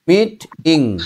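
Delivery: মিট ইং in two separate beats; split syllables. Shown as a demonstration. Teacher showing pupil two syllables, split syllables. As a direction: break after মিট, then say ইং. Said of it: "দুবারে ভাগ করা যায়".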